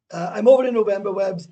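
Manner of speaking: loud, fast, eager announcement; excited. Real, not acted. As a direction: burst out with the news. Calling out to his friend.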